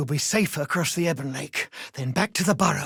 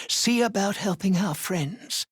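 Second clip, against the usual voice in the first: ordinary talk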